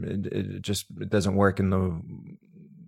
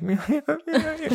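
high, laughing reassurance through tears; laughing yeah; slower than usual. Retold or imitated. Crying woman laughs to reassure her friend, laughing high. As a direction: giggle it out, brushing off the worry.

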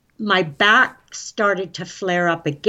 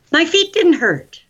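high, raised-voice joyful outburst; excited hollering; 8 semitones higher, 4 dB louder than usual. Real, not acted. Guest tells hosts of pain-free sandals, excited hollering. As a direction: holler it out, overjoyed. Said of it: "Sorry, I'm hollering".

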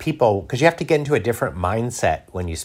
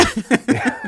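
high laugh. Real, not acted. Laughing at milk mix-up.

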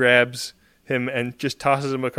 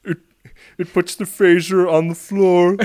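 menacing Buffalo Bill style taunt; creepy Buffalo Troi voice; 5 semitones higher, 7 dB louder than usual. Pretend. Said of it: "Buffalo Troi voice is so creepy".

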